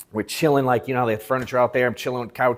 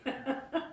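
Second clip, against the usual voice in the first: laugh; 13 semitones higher, 14 dB quieter than usual. Real, not acted. Laughs at the retold put-down.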